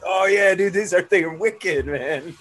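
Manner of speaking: mock Boston accent slipping out; imitating a Boston guy. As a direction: blurt it out in full Boston accent. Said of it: "And he was like".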